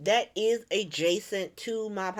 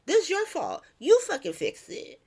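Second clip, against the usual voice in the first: higher-pitched, forceful mock order; angry blaming; 6 semitones higher, 3 dB louder than usual. Lucas blaming the warlord, heated and indignant. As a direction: imitate someone heatedly laying blame.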